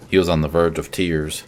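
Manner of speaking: plain reading voice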